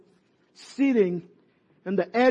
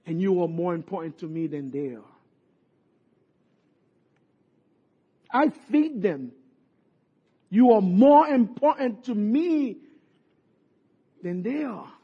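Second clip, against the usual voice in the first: voicing God; 3 semitones lower, 3 dB quieter than usual. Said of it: "God says".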